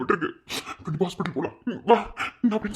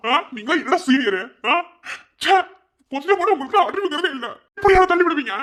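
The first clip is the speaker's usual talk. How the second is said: angry scolding; 7 semitones higher than usual. Acted. Student yelling at seniors who pushed Inba, angry. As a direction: tell them off furiously.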